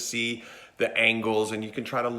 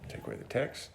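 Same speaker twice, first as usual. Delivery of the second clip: unvoiced stage whisper; hushed whisper to the crew; 3 semitones higher, 10 dB quieter, faster than usual. Real, not acted. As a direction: whisper the direction. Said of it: "whispered direction".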